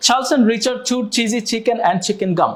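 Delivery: a slow, careful tongue twister demo; slow demo read. Shown as a demonstration. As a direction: say each word slowly and evenly. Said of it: "ব্যাপারটা এরকম হবে শুনতে".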